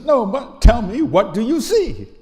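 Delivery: insistent mock-stern mother, high and loud; harsh mother. Mother questioning her crying son, imitated harsh insistence. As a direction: press the child hard for an answer. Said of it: "kind of harsh response".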